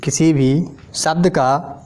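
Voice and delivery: slow, spaced-out dictation for note-taking; drawn out so students can write. Shown as a demonstration. Teacher dictating notes to class, slow and spaced. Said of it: "थोड़ा लिखते हैं".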